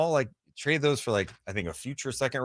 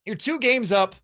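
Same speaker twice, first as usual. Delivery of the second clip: lightly voiced fans, pitched higher; voicing defensive fans; 9 semitones higher than usual. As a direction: make the fans' case in a higher, emphatic voice. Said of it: "fan base that's like".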